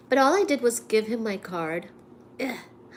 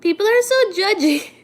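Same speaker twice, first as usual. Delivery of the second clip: exasperated outburst; 10 semitones higher, 8 dB louder than usual. Real, not acted. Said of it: "exasperated complaint".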